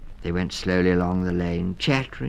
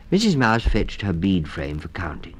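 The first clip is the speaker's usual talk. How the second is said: plain reading voice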